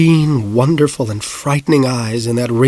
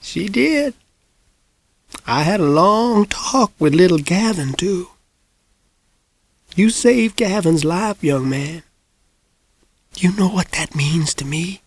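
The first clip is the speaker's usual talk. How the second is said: put-on ancient old woman; 6 semitones higher than usual. A narrator's reading. Old lady voice held.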